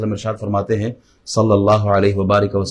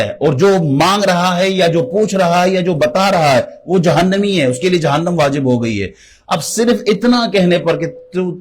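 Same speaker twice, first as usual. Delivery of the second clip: high-pitched, emphatic sermon climax; impassioned preaching; 6 semitones higher than usual. Real, not acted. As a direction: hammer each clause home in a raised voice. (اونچی آواز میں پُرزور وعظ)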